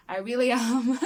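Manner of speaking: giggling through a confession; laughing admission. Real, not acted. Vlogger admits she's a softie, laughing.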